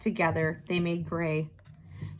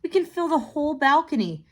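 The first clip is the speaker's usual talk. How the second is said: put-on higher, bright-eyed child's suggestion; little girl Theo; 7 semitones higher than usual. A narrator's reading. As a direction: pitch up like an eager little girl.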